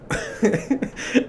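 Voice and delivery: a short, high, airy burst of laughter; breathy high laugh. Real, not acted. Laughing about the boss.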